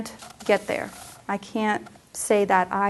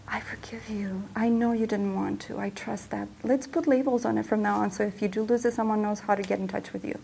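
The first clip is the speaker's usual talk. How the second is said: much softer, near-whispered comfort; tender forgiveness; 5 dB quieter than usual. Retold or imitated. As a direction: soothe yourself like a kind friend would. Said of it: "I couldn't say".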